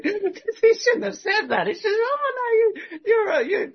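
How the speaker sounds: high laughter through the quote; can barely get the quote out for laughing. Real, not acted. Laughs retelling the man's reaction.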